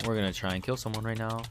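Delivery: normal talking voice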